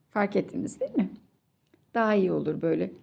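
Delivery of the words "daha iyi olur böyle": ordinary delivery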